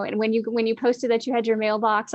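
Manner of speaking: ordinary talk, nothing marked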